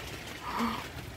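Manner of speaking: quick breathy gasp of surprise; a real gasp of shock; amazed gasp. Real, not acted. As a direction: catch your breath at the sight. Amazed at tomato plants.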